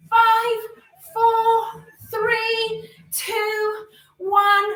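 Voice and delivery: high, loud countdown call; counting down. Real, not acted. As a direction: call each number out loud. Counting down the last seconds.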